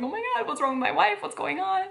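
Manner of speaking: high, fast mock panic; worried husband. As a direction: imitate a frantic husband demanding news. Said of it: "he was so worried".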